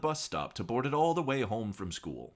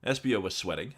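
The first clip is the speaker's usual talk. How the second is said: ordinary delivery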